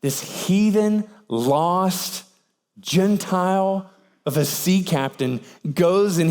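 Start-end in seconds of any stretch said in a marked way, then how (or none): none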